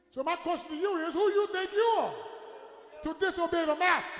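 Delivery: loud preacher's rebuke; full-force preaching. Real, not acted.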